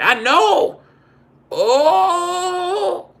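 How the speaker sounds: high, loud shocked auntie shout; screeching auntie. Retold or imitated. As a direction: scold like an outraged auntie. Auntie scolding him.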